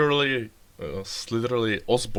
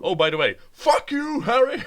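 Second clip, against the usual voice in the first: loud, high-pitched comic insult; imitating Osborn; 13 semitones higher, 6 dB louder than usual. Father casually cursing his son, hammed-up high outburst. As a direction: leap up high and loud for the jab. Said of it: "how basically Osborn goes".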